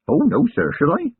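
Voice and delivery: keen country lad proud of his notebook; eager young constable. Acted. Young constable telling his commander, earnest lad voice.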